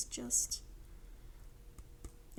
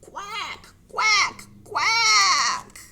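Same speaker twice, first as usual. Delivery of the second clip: high quacks building louder; calling ducks; 12 semitones higher, 15 dB louder, much slower than usual. Pretend. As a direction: quack louder each time, stretching the last. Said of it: "they all called".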